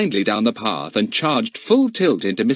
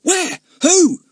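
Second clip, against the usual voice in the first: high, sudden startled questions; boy startled by his sisters' news; 6 dB louder than usual. A narrator's reading. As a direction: jump up in surprise.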